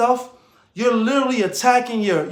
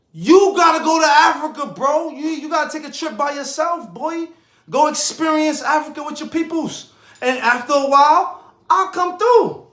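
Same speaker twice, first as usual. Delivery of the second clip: urging friend, raised voice; 6 semitones higher, 3 dB louder than usual. Retold or imitated. Telling friend to go alone.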